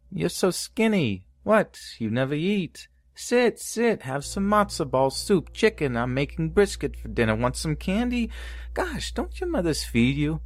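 high, fussing Yiddish bubbe; Yiddish grandma voice. Pretend. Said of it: "in a stereotypical Yiddish grandma voice".

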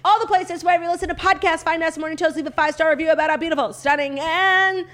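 fast, upbeat host sign-off; show outro. Acted. Podcast outro read.

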